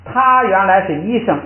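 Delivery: normal talking voice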